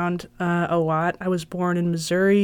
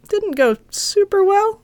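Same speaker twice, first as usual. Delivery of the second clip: wry understatement; 14 semitones higher, 4 dB louder, slower than usual. Real, not acted. Rueful aside.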